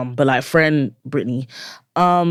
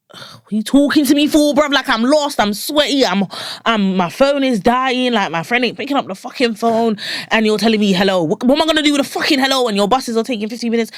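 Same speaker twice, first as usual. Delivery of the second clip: high-pitched, indignant London rant; worked-up, incredulous venting in a raised pitch; exasperated rant; 7 semitones higher than usual. Acted. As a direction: act out being flustered and put out. Acting out irritated London reaction to greeters.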